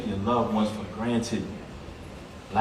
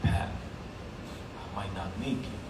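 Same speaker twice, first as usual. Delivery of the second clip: faint whisper; 6 semitones lower, 12 dB quieter, much slower than usual. Retold or imitated. Dying brother's last words retold.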